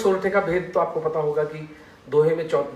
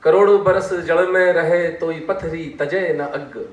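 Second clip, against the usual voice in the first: slow, sustained verse recital; strong opening on each line, easing as it closes; couplet recitation; 2 semitones higher, 6 dB louder, slower than usual. Shown as a demonstration. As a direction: recite the whole doha, stretching each line-end. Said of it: "अब इसको फिर से सुनिए".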